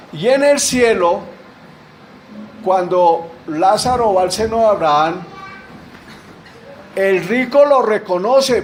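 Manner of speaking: loud, rising sermon delivery; full-force preaching. Acted. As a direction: drive the point home to the congregation. (predicando con fuerza)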